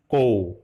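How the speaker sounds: "ko" diucapkan sangat lambat dengan k beraspirasi; pinyin ko. Shown as a demonstration. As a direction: tarik pelan "ko", bukan "go". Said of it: "ko dilafalkan". ("ko" lambat, k beraspirasi, bukan "go")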